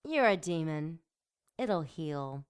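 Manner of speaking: plain reading voice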